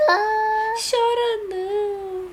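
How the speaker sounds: high, very slow fake sob; mock crying. Mother mimics the crying baby with a playful fake sob. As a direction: pretend to cry along with the baby, stretching it out.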